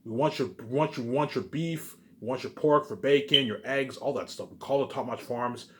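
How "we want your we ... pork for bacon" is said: ordinary delivery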